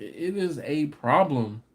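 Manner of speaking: ordinary delivery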